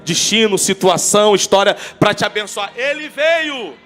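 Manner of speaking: fervent sermon climax, every phrase hammered out; full-force preaching. Acted. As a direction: preach it out to the whole church at full volume.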